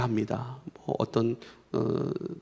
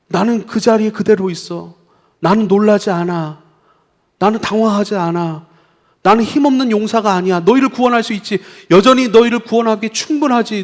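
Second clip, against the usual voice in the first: raised, insistent reassuring voice; emphatic reassurance; 7 semitones higher, 7 dB louder than usual. Acted. God telling wandering Israel He has not left, emphatically reassuring. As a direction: assure them with mounting intensity.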